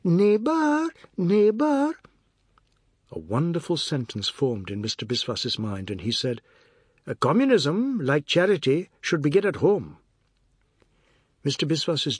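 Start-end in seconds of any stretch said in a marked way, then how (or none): 0.0-1.9 very high, loud wail; drawn-out piercing lament. A narrator's reading. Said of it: "Missus Tulsi began to wail". 7.1-10.0 higher, louder proclamation; swooping oratorical delivery; declaiming. A narrator's reading. Biswas declaims his wonderful sentence.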